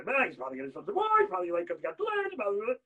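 fast, high, rushed babble; mock excuses. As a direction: gabble excuses into blah-blah. Mocks the mother's hurried excuses.

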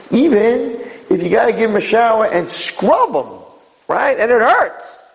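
high-pitched, impassioned push; emphatic insistence. Real, not acted. Rabbi justifying a painful scrubbing, high emphatic insistence. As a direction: drive the point home high. Preacher pressing the point hard.

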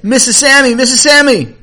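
high, loud, breathless call for the mother; urgent calling. Acted. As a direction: burst in crying out her name. Boy bursts in, urgent.

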